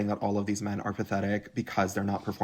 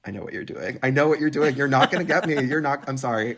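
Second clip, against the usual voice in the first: from knowing mutter to an indignant outburst; mock callout; 5 semitones higher, 5 dB louder than usual. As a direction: catch him out, building to a loud refusal. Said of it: "And it's like".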